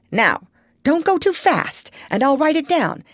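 high, eager doctor; excited. A narrator's reading. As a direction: hurry to take it all down. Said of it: "all excited".

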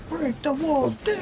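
very high, mock wrestler's catchphrase; Chris Jericho. Gamer quoting a wrestler's catchphrase, high put-on voice. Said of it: "What was it, Chris Jericho's thing?".

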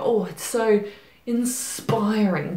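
drawn-out, gushing mock praise; exaggerated, slow-rolling gush. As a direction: stretch it out like an overhyped fan. Mimicking gushing hype about the book.

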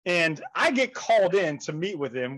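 ordinary talk, nothing marked

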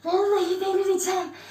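low, soft, stunned voice; stunned hush. Real, not acted. Streamer overwhelmed by the numbers, stunned hush. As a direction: drop right down, overwhelmed.

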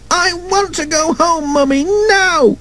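put-on very high, wailing child voice; high-pitched acted scream of a tantruming child. A narrator's reading. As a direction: wail like a child demanding Mummy. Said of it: "screamed the child".

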